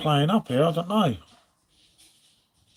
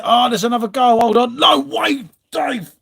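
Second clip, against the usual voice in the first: excited goal reaction; 6 semitones higher, 4 dB louder than usual. Real, not acted. Goal scored live on stream.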